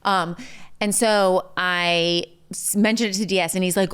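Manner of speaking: ordinary talk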